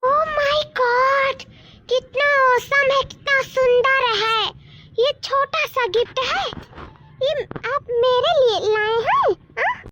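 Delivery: high-pitched, drawn-out gushing; delighted amazement. Acted. Woman receiving brother-in-law's gift, thrilled and amazed. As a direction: gasp with wonder at a gift, pitch lifted.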